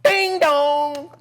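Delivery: loud, high sing-out doorbell chime; ding-dong held high and loud; imitated doorbell. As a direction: ring out like a doorbell.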